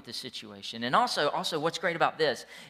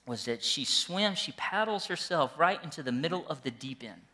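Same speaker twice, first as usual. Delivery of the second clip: ordinary talk, nothing marked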